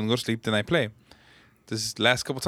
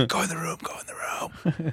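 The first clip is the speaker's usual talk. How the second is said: whisper; 3 semitones higher, 7 dB quieter than usual. Retold or imitated. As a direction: whisper orders so nobody wakes. Whispered callouts, sleeping fiance.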